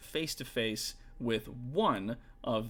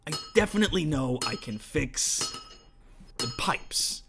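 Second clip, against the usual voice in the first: a hint of orc smith voice, higher; 3 semitones higher, 5 dB louder than usual. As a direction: size up the job. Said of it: "and goes".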